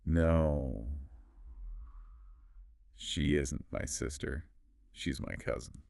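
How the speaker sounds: very slow, low, soft model sentence. Shown as a demonstration. Teacher putting the whole sentence together, very slowly. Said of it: "together now very slowly".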